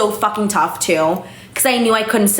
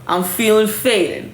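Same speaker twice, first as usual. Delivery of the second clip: slow, woozy tipsy drawl; mock tipsy; slower than usual. To the camera, joking about one glass, woozy slow. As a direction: play up being buzzed on one glass of wine. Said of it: "I'm feeling a little bit like".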